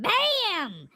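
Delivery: sudden loud shout. Retold or imitated. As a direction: bellow it out. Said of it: "so I said".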